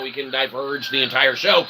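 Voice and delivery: ordinary delivery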